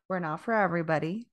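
ordinary talk

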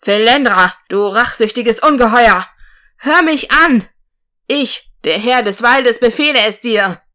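loud commanding roar up at the sky; roaring defiance. A narrator's reading. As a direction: bellow it with all your strength. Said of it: "grollte mit all seiner Kraft in der Stimme".

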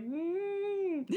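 high, crying whimper; a little sob sound; mock whimper. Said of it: "I ended up crying anyways".

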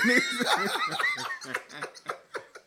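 long high-pitched laugh; drawn-out high cackling; real laughter. Real, not acted. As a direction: crack up for a good while. Cracking up at another alias.

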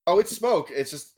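ordinary delivery

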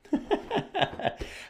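breathy rising laugh; airy laugh. Real, not acted. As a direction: laugh softly at yourself. Laughs at his own joke.

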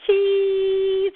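"cheese" with a long, high, loud ee; stretched smiling vowel. Shown as a demonstration. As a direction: sing out the middle of "cheese". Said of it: "when they're brushing their teeth".

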